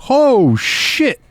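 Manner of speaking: high, startled jump-scare reaction; startled gasp. Retold or imitated. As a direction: blurt it out in fright. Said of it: "It was like".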